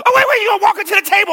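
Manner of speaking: high, fast, loud alarm; mock alarm. [name] pretending to warn blindfolded Kelsey, high and frantic. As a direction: panic at her next step. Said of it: "And I'm".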